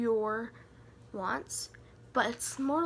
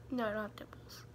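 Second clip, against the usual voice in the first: whispered aside; 3 semitones lower, 7 dB quieter than usual. Real, not acted. Answering herself as an aside.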